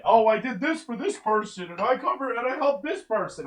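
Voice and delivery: high, nasal mock of him; mocking whiny imitation. Mocking guest's self-praise.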